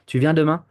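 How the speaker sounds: French question "Tu viens demain" made by pitch; rising tone. Shown as a demonstration. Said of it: "They're gonna rise the tone".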